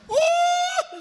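very high shouted uh; a big show-off yelp of course; boastful whoop. Retold or imitated. Street youth boasting he knows the preacher, high shouted uh.

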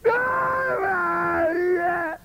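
high crying wail; hammed-up sobbing prayer; mock wailing prayer. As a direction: overwrought theatrical plea. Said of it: "¿Por qué le hablan así al Señor?".

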